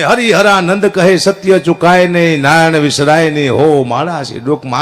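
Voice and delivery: earnest verse recitation. Retold or imitated. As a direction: let the verse settle gently downward. Recites favourite closing bhajan lines earnestly.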